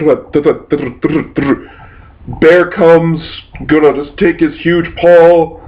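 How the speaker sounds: ordinary talk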